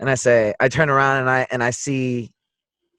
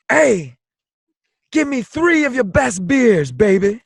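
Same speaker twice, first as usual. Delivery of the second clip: high, swaggering call for service; player's character voice; 8 semitones higher than usual. Acted. Player's character ordering three beers from the bartender, high lilting character voice. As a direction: sing out the order with a high lilt. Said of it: "and I go".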